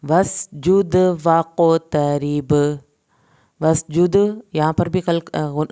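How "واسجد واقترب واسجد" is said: "واسجد" louder, bouncing the d; qalqala. Shown as a demonstration. Teacher to students: slow recitation with qalqala. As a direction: echo the dal in "واسجد". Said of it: "یہاں پر بھی قلقلہ ہوگا".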